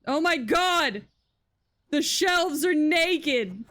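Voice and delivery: loud, high, stretched-out disbelief; shocked exclamation. Real, not acted. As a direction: cry out at the empty shelves. Sees empty shelves.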